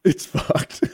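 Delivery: breathy pained laughter; laughing through pain. Real, not acted. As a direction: laugh though it hurts. Hurts to laugh.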